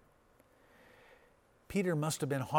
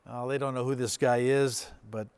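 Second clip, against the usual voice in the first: normal talking voice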